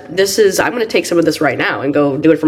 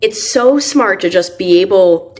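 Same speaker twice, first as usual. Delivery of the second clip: ordinary delivery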